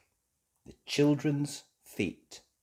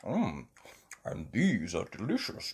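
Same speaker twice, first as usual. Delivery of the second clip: Daddy Pig eating; 3 semitones lower, 5 dB quieter than usual. Acted. Father tucking into pancakes, eating happily. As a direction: lower voice relishing food with munching. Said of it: "tucking in".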